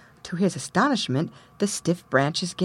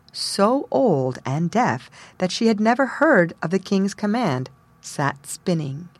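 ordinary delivery

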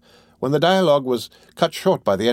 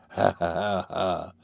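chuckling; 2 semitones lower, 4 dB quieter, slower than usual. A narrator's reading. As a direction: chuckle quietly, trailing softer. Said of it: "in a fit of chuckling".

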